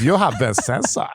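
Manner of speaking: slow, hammed-up German accent; little German guy. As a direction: pop up as a little German censor. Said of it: "this little German guy".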